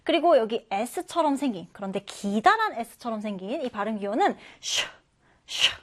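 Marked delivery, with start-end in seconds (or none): 4.6-5.8 "슈" as an isolated sh; sh sound. Shown as a demonstration. Teacher showing the long-S phonetic symbol, "슈" as an isolated sh. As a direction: sound out "슈" as a hushing English sh. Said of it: "이렇게 발음이 됩니다".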